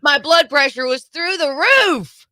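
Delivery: high, worked-up outburst; exasperated complaint. Real, not acted. Host telling co-host how the late game wound him up, exasperated. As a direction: let the pitch climb in exasperation.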